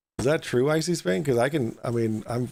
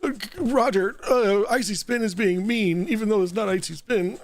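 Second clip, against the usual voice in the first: mock whiny panic; 7 semitones higher than usual. Host mocks the angry caller.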